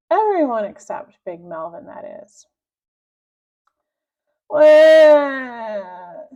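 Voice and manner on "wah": high, loud cartoon sobbing wail; a defeated villain wailing; mock wail. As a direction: bawl like a spoiled child. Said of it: "sobbed Big Melvin".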